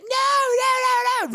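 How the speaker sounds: very high, hammed-up squealed nos; mock shrill no. Podcaster mocking the child refusing, shrieking very high. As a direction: squeal like a stubborn little kid. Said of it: "She's like".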